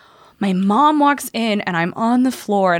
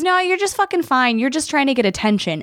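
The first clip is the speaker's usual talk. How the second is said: high-pitched mock scoff; imitated dismissive cousin; 7 semitones higher than usual. Cousin dismissing an anxiety attack, mocking imitation.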